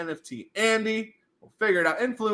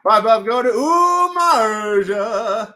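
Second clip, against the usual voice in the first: louder, stretched farewell; loud slow call; 2 semitones higher, 8 dB louder, slower than usual. Pretend. Streamer loudly sending a prize away. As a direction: send it off with a big slow call.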